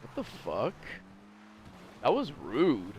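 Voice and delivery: a bystander scolding him, done low and soft; mock offended listener. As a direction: play a prim, offended bystander. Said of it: "someone that's just like".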